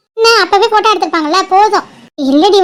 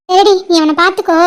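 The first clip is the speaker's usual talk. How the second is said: ordinary talk, nothing marked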